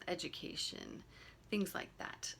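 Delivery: ordinary talk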